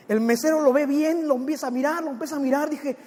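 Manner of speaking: normal talking voice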